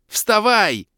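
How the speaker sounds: loud high panicked shout; panicked yell. Acted. Hero yelling at fallen warrior, panicked yell. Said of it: "в панике заорал я".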